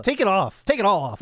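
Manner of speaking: high, loud irritated plea; exasperated demand. Real, not acted. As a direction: demand it loudly, fed up. Irritated by creaking leather jacket.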